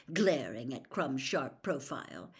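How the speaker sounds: usual speaking voice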